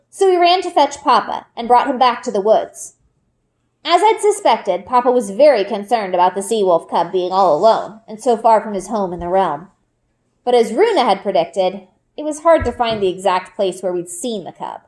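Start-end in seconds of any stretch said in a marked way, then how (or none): none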